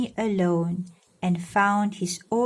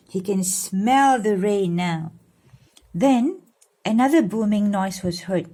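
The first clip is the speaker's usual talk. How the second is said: plain reading voice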